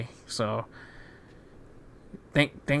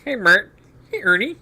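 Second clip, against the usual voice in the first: high, loud puppet voices trading names; Bert and Ernie impression; 8 semitones higher, 6 dB louder than usual. Hosts goofing, calling out in high puppet voices. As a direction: squeak the names back and forth like puppets. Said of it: "That's enough mockery of, uh, impressions".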